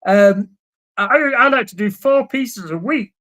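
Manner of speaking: whiny nerd impression; nerdy voice. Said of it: "I won't do my nerdy voice".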